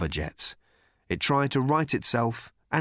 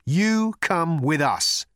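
slow, emphatic order; raised, drawn-out command; firm insistence; 6 semitones higher, 4 dB louder, slower than usual. A narrator's reading. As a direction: spell out the demand word by word. Arthur insists.

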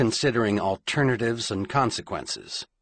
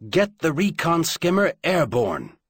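higher, louder snapped order; sharp command; 7 semitones higher, 4 dB louder than usual. A narrator's reading. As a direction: bark the order out. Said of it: "he snapped to Owl".

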